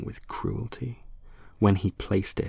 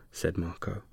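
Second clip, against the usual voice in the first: usual speaking voice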